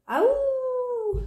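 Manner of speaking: long, very high, sustained howl; wolf howl. A narrator's reading. Little Bear plays at being a wolf, long howl. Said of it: "he could hear wolves howling".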